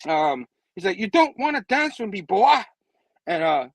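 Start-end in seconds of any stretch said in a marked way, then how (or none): 1.0-2.5 raised-pitch, loud mock threat; put-on tough challenge; fighting taunt. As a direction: taunt him, punching the last word.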